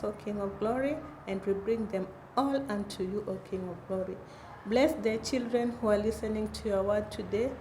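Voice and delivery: soft, hushed prayer voice. Acted. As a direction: pray quietly, reverently. Leads children in prayer.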